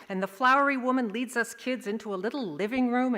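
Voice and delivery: plain reading voice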